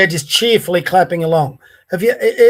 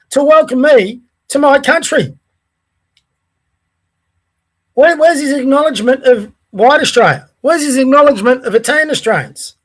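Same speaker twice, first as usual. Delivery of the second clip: indignant, higher-pitched demand; angry rant; 4 semitones higher than usual. Real, not acted. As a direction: fire it out in real outrage. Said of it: "I've been this angry".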